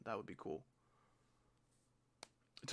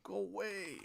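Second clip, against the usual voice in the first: much higher fed-up go away; exasperated whine; 7 semitones higher, slower than usual. Real, not acted. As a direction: whine it again like you're done. Fed up with fourteen-year-olds.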